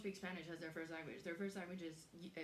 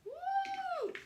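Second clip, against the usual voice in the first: excited whoop; 11 dB louder than usual. Real, not acted. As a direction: squeal with delight. Excited cry over spicy food.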